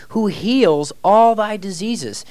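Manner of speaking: slow, high, drawn-out scripture reading; emphatic scripture. Acted. Teacher proclaiming verse to students, slow and emphatic. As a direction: lean hard on every word, preaching it.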